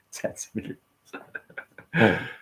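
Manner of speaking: breathy laugh through the words; giggling at his own exaggeration, repeating it. Real, not acted. Repeating his own boast to viewers, laughing.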